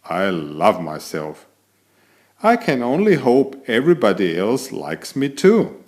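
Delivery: ordinary delivery, nothing marked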